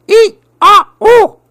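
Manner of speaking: high, loud panicked monkey cries; monkey shrieks. Acted. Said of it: "went the monkey".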